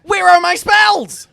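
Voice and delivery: high, loud indignant cry; mock outrage. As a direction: demand it in comic outrage.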